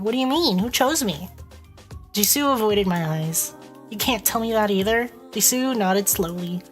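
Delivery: normal talking voice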